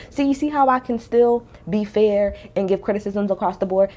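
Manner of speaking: ordinary talk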